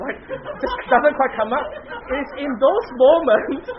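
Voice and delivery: chuckling through the point as it lands; laughing while talking. Real, not acted. Teacher laughing warmly at her own example.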